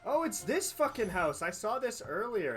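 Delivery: usual speaking voice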